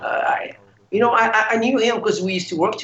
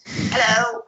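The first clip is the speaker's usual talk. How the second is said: a mock raspy-free friendly hello, pitched up; 8 semitones higher than usual. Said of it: "who's like".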